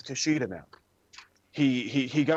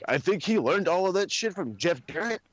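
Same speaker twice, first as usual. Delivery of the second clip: ordinary delivery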